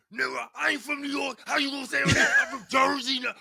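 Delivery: loud, high-pitched retold shouting; offended outburst. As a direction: act out an indignant man yelling his correction. Acts out offended man checking him.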